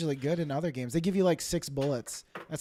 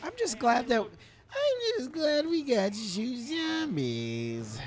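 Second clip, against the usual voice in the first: high, slow, breaking voice; cracking voice; 9 semitones higher, much slower than usual. Real, not acted. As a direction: voice cracking and straining. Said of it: "you can't even talk anymore".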